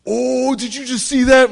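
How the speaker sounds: high, loud alarmed question; a friend suddenly spotting something; startled friend. Retold or imitated. Friend in the car spotting something, loud and high. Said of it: "one of my friends goes".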